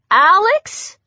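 high, rising surprise; astonished rising query; surprised question. A narrator's reading. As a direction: ask it in startled recognition. Astonished recognition of the name.

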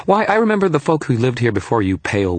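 high-pitched little fairy lecturing; indignant small fairy, starting high and sinking; Toot's lecture. A narrator's reading. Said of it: "I bore the lecture".

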